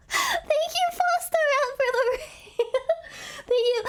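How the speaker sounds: very high, excited thank-you; excited squeal. Real, not acted. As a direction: squeal your thanks for the raid. Thanking a raider on stream.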